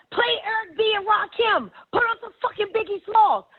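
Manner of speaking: high, excited shout-out to the bus; hyped party call. Retold or imitated. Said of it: "Then she gets up".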